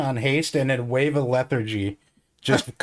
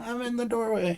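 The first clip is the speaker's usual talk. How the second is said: high, quiet repeated protest; softly insisting in a high voice; soft insistence; 8 semitones higher, 6 dB quieter than usual. Acted. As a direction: repeat it small and soft, pitch raised.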